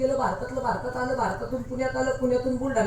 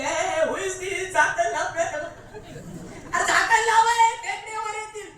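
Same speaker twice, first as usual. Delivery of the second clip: higher, impatient American officer; impatient official; 7 semitones higher, slower than usual. Retold or imitated. American officer orders the Indian boy to close the jar, impatient and higher. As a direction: hurry him, a lightly voiced foreign officer.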